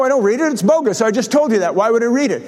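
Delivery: ordinary talk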